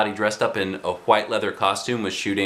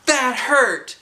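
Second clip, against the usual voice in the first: very high whine; hurt complaint; 14 semitones higher, 3 dB louder than usual. Acted. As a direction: complain about the pain. Girl hit by ball.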